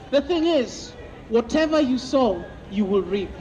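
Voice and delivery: ordinary talk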